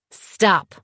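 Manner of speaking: hissed warning. A narrator's reading. Woman cutting off her ex's prying, angry hiss. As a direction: cut him off in anger. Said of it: "I hissed".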